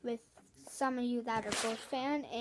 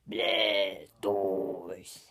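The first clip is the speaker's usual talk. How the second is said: a much lower growl, loud and strained; angry Blastoise sound; 6 dB louder than usual. Shown as a demonstration. Kid doing his angry Blastoise for viewers, low and loud. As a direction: growl it like an enraged turtle. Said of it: "this is my angry Blastoise sound".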